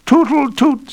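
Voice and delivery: very high, loud tootle; flute toot. A narrator's reading. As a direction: sing it like a flute. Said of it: "sang the flute".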